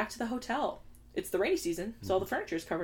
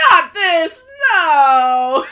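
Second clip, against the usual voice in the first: very loud hammed-up wail; imitated horrified cry; 8 semitones higher, 17 dB louder, much slower than usual. Podcaster imitating Eric watching the scene, loud horrified cry. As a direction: howl it out in mock horror. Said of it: "Eric went".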